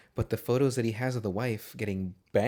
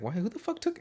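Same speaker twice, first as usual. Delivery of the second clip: baffled disbelief; 12 semitones higher than usual. Retold or imitated. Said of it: "I was like".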